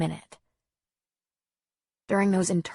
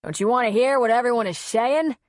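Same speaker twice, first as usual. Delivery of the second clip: raised, louder and higher demand; angry shout; 4 semitones higher, 6 dB louder, faster than usual. A narrator's reading. Griff demanding his wife listen, angry shout. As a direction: shout after someone walking away. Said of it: "he shouted after her".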